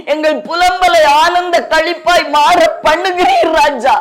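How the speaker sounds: high, chanted plea to God; fervent prayer. Real, not acted. Preacher praying for the lamenting congregation, fervent and high. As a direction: build from address into high, pleading prayer.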